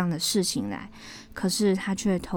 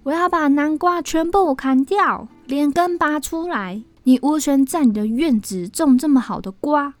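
high, raised angry declaration; loud threat; 7 semitones higher, 6 dB louder than usual. A narrator's reading. Brother with a knife threatening to chop every pumpkin, loud. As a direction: threaten loudly, with force. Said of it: "就大声地说".

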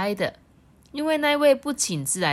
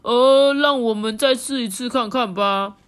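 high, loud, urging frog; determined frog; 6 semitones higher, 7 dB louder than usual. Acted. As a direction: push everyone to try again. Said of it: "这时候第二只青蛙说".

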